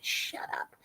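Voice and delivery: a hushed, breathy retort; a low, unvoiced brush-off; whispered shut up. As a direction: whisper it back at him, half joking. Telling her husband to shut up.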